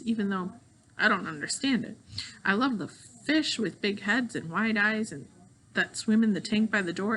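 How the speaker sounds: ordinary delivery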